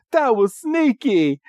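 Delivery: high-pitched cartoon villain complaint; mock indignation. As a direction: imitate an affronted villain. Voices Jesse annoyed at sneak attack.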